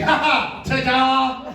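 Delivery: put-on high, loud, gleeful kid reveal; triumphant small-child voice; little boy's ta-da. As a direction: show off like a three-year-old magician. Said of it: "And he's like".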